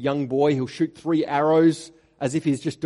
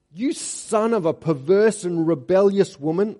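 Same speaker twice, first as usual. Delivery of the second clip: loud, higher, furious royal insult; angry insult; 5 semitones higher, 8 dB louder than usual. Acted. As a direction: spit it at your son in rage. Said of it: "Saul's anger flared up at Jonathan".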